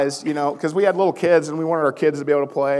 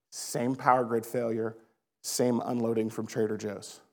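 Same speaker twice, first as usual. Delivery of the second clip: ordinary talk, nothing marked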